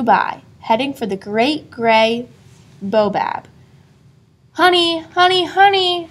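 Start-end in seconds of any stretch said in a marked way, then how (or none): 4.5-6.1 high, bright sing-song call; cried out, grinning. A narrator's reading. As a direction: call him on with a grin. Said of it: "she cried, grinning".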